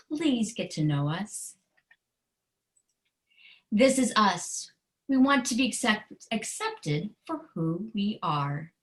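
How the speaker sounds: steady storybook read with a firm refrain; reading to children. Acted. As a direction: read each page like a gentle confession to kids. Author reads her picture book aloud.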